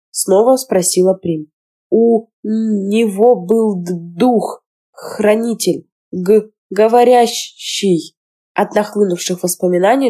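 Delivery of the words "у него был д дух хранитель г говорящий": slow, broken speech, catching on first sounds; frightened boy stammering about the spirit; stuttering. Acted. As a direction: stammer it out, shaken with fear. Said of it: "он начал заикаться".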